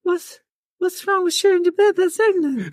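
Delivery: very high falsetto pop star impression. Shown as a demonstration. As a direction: push right up into a tiny falsetto.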